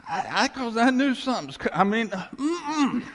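jumpy, half-laughing alarm; alarmed outburst. Retold or imitated. Horrified reaction, louder and higher.

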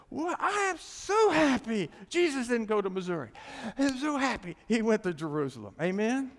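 loud, high, ringing preacher's cry; full-force preaching. Acted. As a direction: shout it out to the whole church.

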